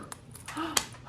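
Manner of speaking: breathless whispered gasp before a sneeze; mock gasp. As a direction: suck in air like a sneeze is coming. Acting out allergic sneezing.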